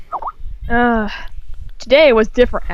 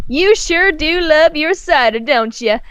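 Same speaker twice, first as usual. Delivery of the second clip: lightly higher teasing country mare; a touch of sisterly teasing; Applejack's voice; 5 semitones higher than usual. A narrator's reading. Applejack teasing her brother about his cider. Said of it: "Applejack laughed".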